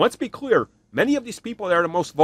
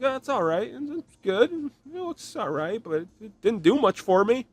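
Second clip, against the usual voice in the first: mild reaction; 6 semitones higher, 3 dB quieter than usual. Retold or imitated. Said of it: "I was like".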